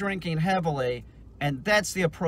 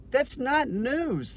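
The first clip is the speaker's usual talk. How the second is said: slow and higher, leaning hard on not; emphatic denial; 7 semitones higher, much slower than usual. Real, not acted. Ranting to viewers that Fox isn't news, slow and emphatic. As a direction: draw out "That's not news" with weight on "not".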